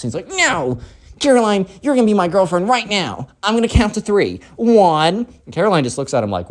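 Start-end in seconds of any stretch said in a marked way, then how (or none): 0.3-5.2 put-on high, bossy kid voice; spoiled kid ultimatum. Spoiled kid counts down at Caroline, bossy ultimatum. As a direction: lay down the threat in a high, bratty voice.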